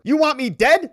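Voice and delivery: loud, high, stinging accusation; angry outcry. Acted. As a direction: hurl it back at her. Angry reply text voiced.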